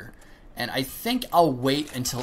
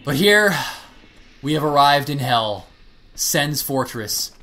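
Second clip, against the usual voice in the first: dramatic announcer; 2 semitones higher, 7 dB louder than usual. Acted. Dramatic announcement of Sen's Fortress.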